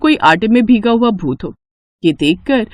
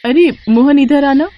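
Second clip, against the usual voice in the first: higher, raised call to the guard; young woman calling out; 4 semitones higher than usual. Acted. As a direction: call him over, then ask eagerly. Aarti calls the guard.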